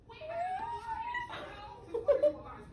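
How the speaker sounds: a high, breathless squeal of a laugh with a catch of tears. Real, not acted. Mother overcome by her newborn son, squealing laugh. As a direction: giggle squeaking up high, almost crying.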